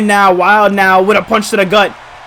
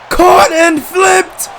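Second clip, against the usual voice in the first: shouting; 11 semitones higher, much slower than usual. Real, not acted. Repeated call of the move.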